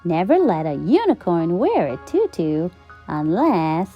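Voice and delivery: usual speaking voice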